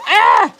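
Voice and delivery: sudden high sharp cry of alarm; a quick real yelp as the stir bars drop; startled yelp. Real, not acted. Chemist losing stir bars mid-stream, startled yelp.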